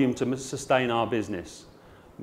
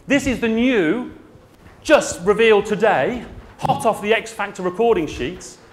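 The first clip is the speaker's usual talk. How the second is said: mock announcer; 8 semitones higher, 6 dB louder than usual. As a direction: build it up like a show announcer. Mock grand reveal of an anthem.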